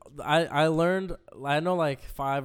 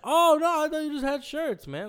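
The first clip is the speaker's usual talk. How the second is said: high, pleading mock customer; whiny customer; 13 semitones higher, 6 dB louder than usual. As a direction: squeak it out like a caught freeloader. Customer surprised about paying.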